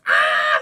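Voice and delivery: very loud, drawn-out mock scream; imitated kids' scream. Acting out Lex and Tim as the car flips, screaming. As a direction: scream your head off like terrified kids. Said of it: "they're just screaming their heads off".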